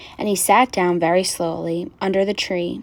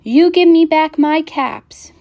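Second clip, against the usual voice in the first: high, loud, drawn-out demand; scolding peddler; 7 semitones higher, 6 dB louder than usual. A narrator's reading. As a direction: scold slowly, stretching the demand. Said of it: "shaking his finger at them".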